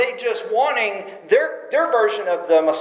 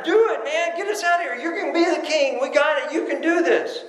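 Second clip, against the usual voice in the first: imitating the crowd's cry; 7 semitones higher than usual. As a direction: play the crowd egging him on. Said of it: "Screaming out".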